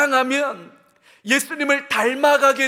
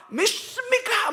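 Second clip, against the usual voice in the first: "믿습니까?" in a Gyeongsang drawl, pitched high, laughing; hammed-up Gyeongsang preacher's "믿습니까?"; 경상도 사투리로 웃으며 흉내; Gyeongsang dialect preacher; 10 semitones higher than usual. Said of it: "경상도 방언을 살짝 섞어서 경상도 사투리".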